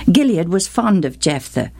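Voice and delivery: ordinary delivery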